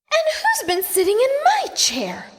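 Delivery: high whispered mock scream; a hushed high shriek; Mother Bear screaming. A narrator's reading. Mother Bear shrieks about her chair in a high whisper. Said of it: "screamed Mother Bear".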